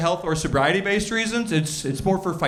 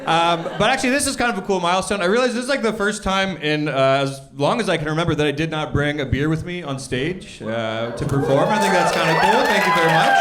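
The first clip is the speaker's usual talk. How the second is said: ordinary talk, nothing marked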